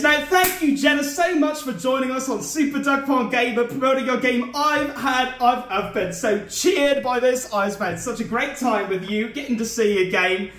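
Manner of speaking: ordinary talk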